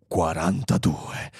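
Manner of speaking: low, slow pronouncement; infinitely calm, solemn. A narrator's reading. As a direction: deliver it as a grave oracle computer. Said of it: "con infinita calma e solennità". (calma solenne, grave e lenta)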